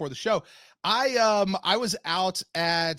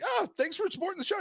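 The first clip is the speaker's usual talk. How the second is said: cheery thanks; 13 semitones higher, faster than usual. Retold or imitated. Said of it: "I was like".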